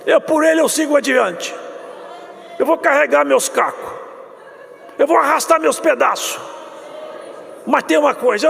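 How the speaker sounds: high, loud full-force preaching to the congregation; fiery preaching. Acted. Pastor preaching the suffering of Jesus, loud and high.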